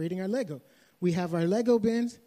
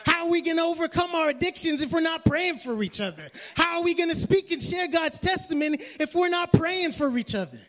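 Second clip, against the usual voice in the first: raised, high, fervent preaching voice; full-force pulpit exhortation; 9 semitones higher than usual. Acted. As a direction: preach it out to the church. Preaching to the congregation.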